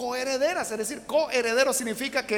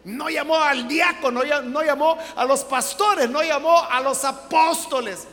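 louder, slower building preaching; 5 semitones higher, 7 dB louder than usual. Real, not acted. Preacher stressing Jesus chose women, forceful. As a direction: hammer each phrase from the pulpit.

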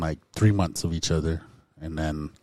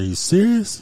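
mild incredulous question, pitched high and louder; retold disbelief; 8 dB louder than usual. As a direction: ask it as if you can't believe it. Disbelief at learning the day.

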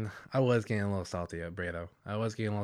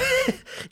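high burst of laughter; loud laugh; 8 dB louder than usual. Real, not acted.

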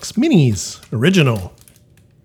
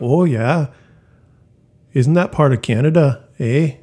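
put-on heavy Canadian accent. Guest imagining far-north Canadians talking, heavy Canadian accent. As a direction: caricature Canadian with a tagged-on "eh". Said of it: "the heavy, like".

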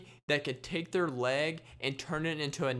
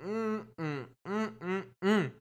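grossed-out humming; 5 semitones higher, slower than usual. Shown as a demonstration. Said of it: "for me to go".

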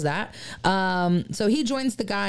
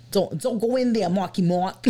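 put-on Boston accent. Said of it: "it is a Boston accent".

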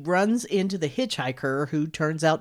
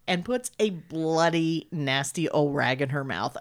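ordinary talk

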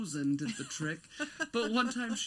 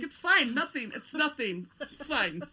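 higher, hurried fake-casual denial; mock nervous denial; 7 semitones higher, 4 dB louder than usual. Caught doodling sister's name, nervously insists it's nothing. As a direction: brush it off like you're caught. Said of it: "I'm like".

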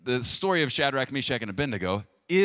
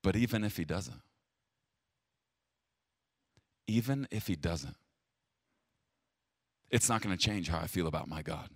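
softer, low and firm; quiet steadfast faith; 7 semitones lower, 7 dB quieter than usual. Retold or imitated. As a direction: say it quietly, as a vow you will keep. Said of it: "You know what's harder to say".

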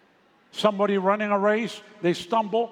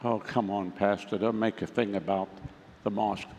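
low, softer, mildly imitated grumble; mock an objector brushing the matter off, pitched low; dismissive complaint; 10 semitones lower, 6 dB quieter than usual. Congregant brushing off the pastor about masks, mock complaint.

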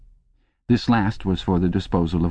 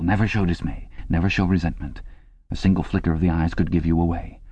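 ordinary delivery

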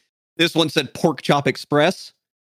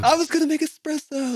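movie quote voice; 12 semitones higher than usual. Retold or imitated.

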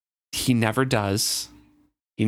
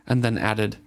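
ordinary delivery

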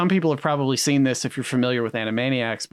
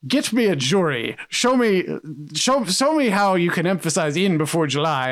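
indignant, higher-pitched mock tirade; Orson Welles; 5 semitones higher than usual. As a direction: challenge the director like an affronted star. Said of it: "and he's like".

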